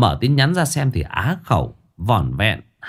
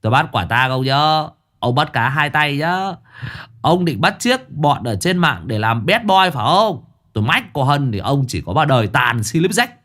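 teasing accusation; 6 semitones higher than usual. Acted. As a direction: point and crow at him. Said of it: "Cậu Cẩn chỉ chỉ".

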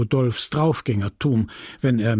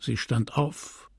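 plain reading voice

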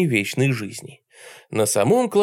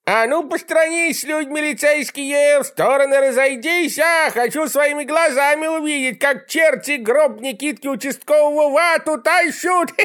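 put-on much higher, gleeful shouting old deacon; gleeful yelling deacon; 14 semitones higher, 3 dB louder than usual. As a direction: crow it out in gleeful triumph. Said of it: "счастливые вопли дьяк Филимона Груздева".

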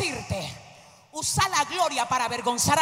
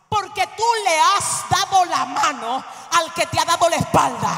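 ordinary talk

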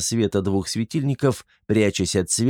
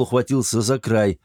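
ordinary delivery

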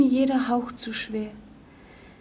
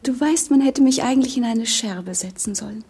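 normal talking voice

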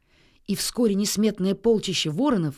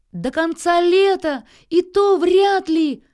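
high, loud, drawn-out jeer; mocking shout; 9 semitones higher, 9 dB louder, slower than usual. A narrator's reading. Nils heckles a drake vowing lifelong love, high loud shout. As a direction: holler it down from above. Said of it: "прокричал Нильс".

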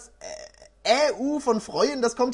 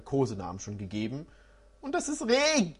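usual speaking voice

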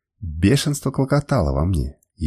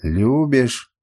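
ordinary delivery

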